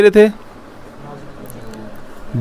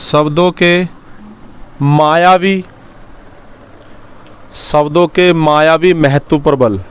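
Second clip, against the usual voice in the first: slow, word by word so students can write; slow dictation; slower than usual. Shown as a demonstration. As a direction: dictate the point slowly. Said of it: "second लिखेंगे बेटा".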